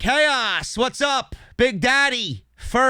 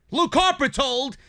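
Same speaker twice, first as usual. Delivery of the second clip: ordinary talk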